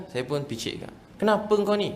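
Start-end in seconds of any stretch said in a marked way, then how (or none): 1.2-1.9 higher-pitched, imitated irritated father; mock gruff scolding. Father questioning son massaging his feet, mock irritation. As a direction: scold lightly in an irritated dad's voice.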